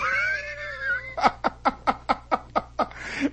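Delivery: breathy high laugh, carried away; soft, high helpless laugh; high laughing shriek. Real, not acted. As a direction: crack up at the twist. Teller laughing at the booze twist.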